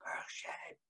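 soft, breathy murmur; hushed murmur; said quietly. Shown as a demonstration. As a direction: whisper it under your breath. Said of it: "We say it quiet".

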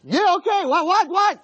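high, impatient eagerness; all excited. Acted. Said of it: "He was all excited".

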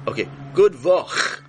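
drawn-out patient "good vach"; host demonstrating the word; Yiddish vach. Shown as a demonstration.

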